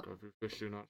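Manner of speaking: talking through squeezed cheeks; squished cheeks. Real, not acted. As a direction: cheeks squeezed, soft and muffled. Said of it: "whose cheeks you're holding".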